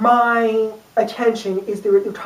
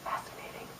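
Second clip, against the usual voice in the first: breathy whisper, hushed; whispered fascinating; 23 dB quieter than usual. Retold or imitated. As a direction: whisper it in awe like a kid at the TV. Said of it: "just be like".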